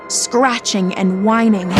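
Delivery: plain reading voice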